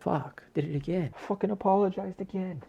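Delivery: small, high, soft voice; mock self-reproach. Catching himself apologizing to listeners, mock self-reproach. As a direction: squeak out a hushed curse at yourself.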